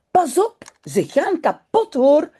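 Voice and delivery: high-pitched acted child's warning; urgent warning. Janneke sees her slippers being stretched, urgent warning. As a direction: warn anxiously, pitch jumping up. Child warns friend in story.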